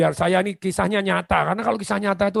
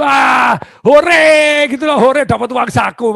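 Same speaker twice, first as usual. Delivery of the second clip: cheering shout; 8 semitones higher, 9 dB louder, slower than usual. Acted. Applicant cheering pocket money.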